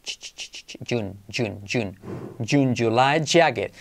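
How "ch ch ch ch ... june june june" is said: soft voiceless "ch" building into "June"; from a hissed "ch" to a voiced "June". Shown as a demonstration. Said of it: "Es como una che". (de "ch" soplada a "June" sonoro)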